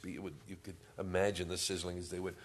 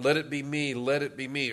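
mock pleading shout, raised pitch; crying out; 6 semitones higher, 8 dB louder than usual. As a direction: imitate a crowd calling out eagerly, louder and higher. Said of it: "crying out".

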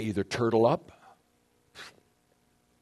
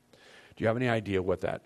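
normal talking voice

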